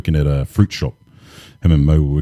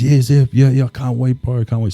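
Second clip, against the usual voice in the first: eager, higher-pitched retold reply; so happy; 9 semitones higher than usual. Worker accepts the cleaning job from recruiter, so happy. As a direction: gush it lightly, as a thrilled new hire would. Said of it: "So happy".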